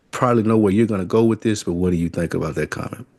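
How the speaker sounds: usual speaking voice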